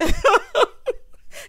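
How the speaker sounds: high shrieking laugh; loud howling laugh; screaming laugh. Real, not acted. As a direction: cackle out loud, can't hold it. Burst of laughter.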